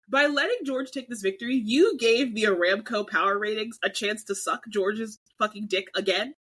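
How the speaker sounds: ordinary talk, nothing marked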